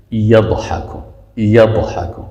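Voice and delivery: "یضحکو" with a full, heavy d from the tongue side; heavy dad sound. Shown as a demonstration. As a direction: thicken the consonant after ya in "یضحکو". («یضحکو» با ضاد پر)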